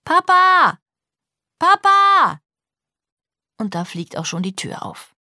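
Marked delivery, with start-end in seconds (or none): 0.0-2.4 high, loud cry for dad; scared child shouting for father; very loud call. A narrator's reading. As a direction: call out at full voice, long last syllable. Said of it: "Ganz laut ruft sie".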